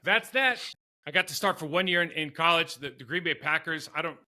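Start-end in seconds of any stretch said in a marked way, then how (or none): 0.0-0.6 high, loud, mock-stunned well; deflated shock pushed way up high and loud; stunned exclamation. Player to himself after the coach's joke, high loud stunned well.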